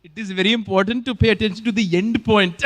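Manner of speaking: loud, playful Malayali accent imitation; mock Malayali accent. Host mimics the chatbot explaining bad APIs, mock Malayali accent. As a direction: parrot it in an exaggerated Malayali accent. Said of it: "with a Malayali accent".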